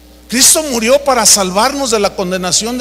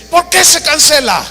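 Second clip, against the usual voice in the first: high-pitched imitated outrage; angry demand; 6 semitones higher than usual. Famous preacher pounds the airline desk, imitated angry demand. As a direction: demand answers like a fuming passenger. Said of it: "golpea la, la, el escritorio".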